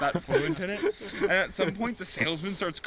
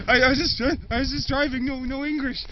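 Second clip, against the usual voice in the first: flustered broken-English reply, a touch higher; lightly accented frightened driver; 7 semitones higher, 6 dB louder than usual. Retold or imitated.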